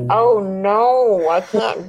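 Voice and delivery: loud, drawn-out mock dismay; dismayed refusal. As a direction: recoil as if turned off. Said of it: "I'm like".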